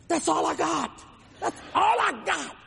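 high-pitched, drawn-out plea; pleading protest. Retold or imitated. Preacher arguing with God over his money, high pleading protest. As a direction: plead it high, stretching the second time.

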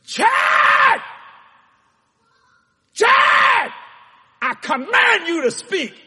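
high, screaming command, long-held name; all-out holler. Retold or imitated. Preacher commands a boy in a coma to wake, all-out high holler. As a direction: holler with everything you have, commanding. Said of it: "I hollered like I had never hollered".

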